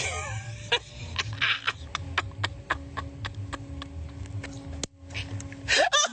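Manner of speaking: high, wheezing, breathless laugh that won't stop; hysterical laughter. Real, not acted. Drunk streamer losing it at his friend's remark, wheezing laughter.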